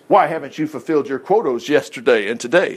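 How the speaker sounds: ordinary talk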